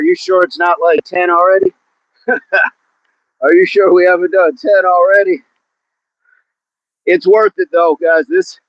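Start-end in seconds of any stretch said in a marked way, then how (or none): none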